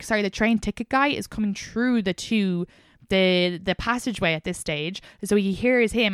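usual speaking voice

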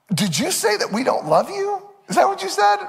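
rising, incredulous sarcastic question; high sarcastic parent mocking the kid; mock sarcastic disbelief. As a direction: feign shock that she could say it. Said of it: "heavy dose of sarcasm".

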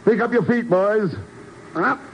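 high-pitched hurrying call. Acted. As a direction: hustle the men along. Hurrying enemy soldiers before grenade.

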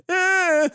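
high, whiny crying noise; comic burst of bawling; mock wail. Imitates crying at the funeral.

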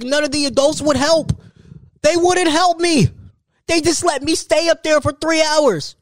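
high, put-upon complaint; mock outrage at being abandoned; exasperated complaint. Real, not acted. Complaining nobody rescued him.

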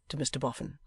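usual speaking voice

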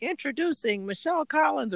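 put-on tiny kid announcer. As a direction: play a little girl doing a show intro. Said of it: "like a real tiny one".

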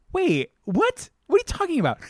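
high, disbelieving voice; incredulous. Retold or imitated. Viewer baffled by the helpless heroine, high disbelief. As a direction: react like the scene makes no sense.

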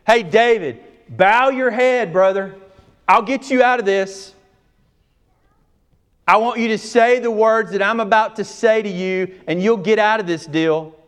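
high, loud, coaxing revival pitch; mock pushy preacher. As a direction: ham up a slick altar-call preacher. Mocking a pushy altar-call preacher.

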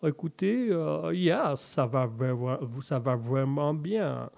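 slow, drawn-out mock-English French; courteous English lord with a bad accent. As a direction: take your time, put-on English accent. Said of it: "et vous, monsieur Malone".

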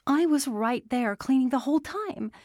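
high-pitched, insistent objection; indignant protest. A narrator's reading. She protests his explanation.

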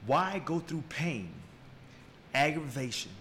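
ordinary talk

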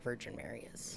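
hushed dramatic whisper; whispered reveal. Real, not acted. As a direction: say it under your breath.

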